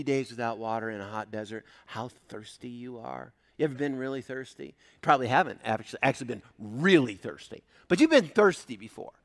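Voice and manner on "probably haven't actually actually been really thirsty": ordinary talk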